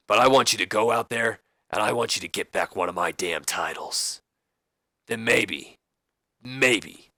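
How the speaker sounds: gruff McMahon voice. Retold or imitated. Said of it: "he goes".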